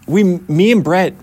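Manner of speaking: normal talking voice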